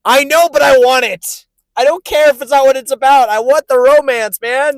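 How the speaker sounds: loud, high pleading; impassioned protest. Real, not acted. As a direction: insist on it like a worked-up romantic. Heated plea for romance against friend.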